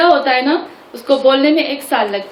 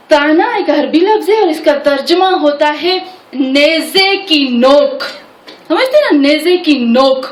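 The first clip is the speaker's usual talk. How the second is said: ordinary talk, nothing marked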